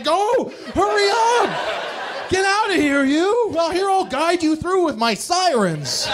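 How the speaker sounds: high, laughing cop voice; eager cop. Retold or imitated. Cop waving a speeding driver on, high excited voice. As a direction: wave him through, high and eager. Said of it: "he would probably be just like".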